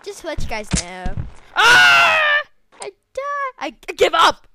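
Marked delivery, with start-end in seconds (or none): 1.6-2.2 sudden high yelp of defeat; loud scream. Real, not acted. Gamer dies in game, loud high scream. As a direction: shriek as you die.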